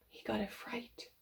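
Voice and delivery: breathy hushed suspense; whisper. A narrator's reading. Telling the child of the fright, whispered. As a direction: whisper it like a spooky secret.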